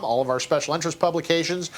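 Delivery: ordinary delivery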